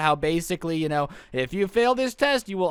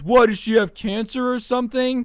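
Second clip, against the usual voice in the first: put-on blurting kid; 4 semitones higher, 6 dB louder than usual. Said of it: "And Harry just goes".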